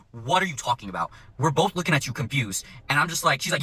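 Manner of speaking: ordinary talk, nothing marked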